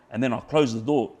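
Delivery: ordinary talk, nothing marked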